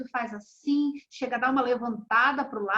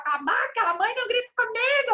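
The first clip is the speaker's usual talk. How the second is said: put-on much higher, fast, childish retort yelled back; mock child yelling back; 9 semitones higher, faster than usual. A daughter yelling at her shouting mother, mock high fast retort. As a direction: toss it off like a bratty kid shouting at mom.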